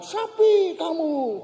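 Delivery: high, slow echo of an insult; drawn-out high call bouncing back; echo answering back. Retold or imitated. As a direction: imitate a ravine echo, vowels stretched. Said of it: "sana jawab".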